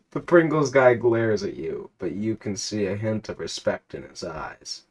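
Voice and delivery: measured storyteller read of a game scene; reading out the game's story to a friend, narrator voice; story narration. A narrator's reading. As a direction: narrate the adventure steadily.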